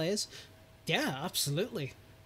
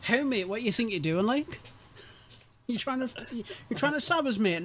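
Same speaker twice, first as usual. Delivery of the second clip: mock lad confrontation; 6 semitones higher, 5 dB louder than usual. Chicken voiced in a silly scene.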